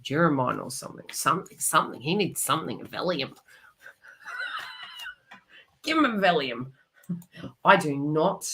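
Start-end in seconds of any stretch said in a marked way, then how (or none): 4.3-5.1 short high-pitched giggle, light and airy. Real, not acted. Reader giggling at her own Valium joke, high soft laugh. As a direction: let a quick soft squeak of a laugh slip out.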